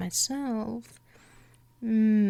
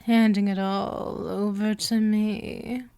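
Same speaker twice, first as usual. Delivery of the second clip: normal talking voice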